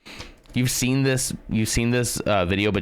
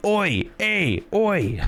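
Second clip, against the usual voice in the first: higher mock Cockney "oi, hey" with a laugh; mock British; 9 semitones higher, much slower than usual. Joking that Londoners go around saying oi, in mock British. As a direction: ham up a Londoner calling out. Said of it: "They're not going around going".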